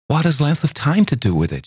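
high, eager protest; protesting girl. A narrator's reading. Girl protests to Campbell, high and eager. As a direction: brush his doubt aside.

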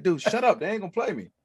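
high, loud protest; loud high-voiced disbelief at a pal; exasperated comeback. Snapping back at excited friend.

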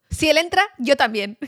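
roommate's declaration. Retold or imitated. Roommate deciding to follow the other car in, emphatic. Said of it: "mi compañera de piso, Cagolín, dijo".

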